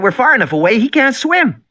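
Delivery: put-on high bratty girl voice; girl's voice. A narrator's reading. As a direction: voice the little girl, dismissive. Voicing Lex.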